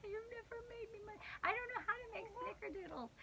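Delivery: high, laughing through the words; giggling while she teases. Real, not acted. Laughing while teasing husband.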